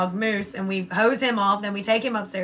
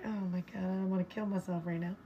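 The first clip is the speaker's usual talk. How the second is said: much softer, defeated retold thought; despairing aside; 2 semitones lower, 12 dB quieter than usual. Mom to herself after scolding son, quiet despair. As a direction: drop it to a soft, weary aside.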